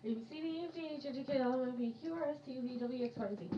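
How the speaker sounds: fast alphabet. Shown as a demonstration. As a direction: rattle off the letters fast. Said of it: "how fast can you say the alphabet".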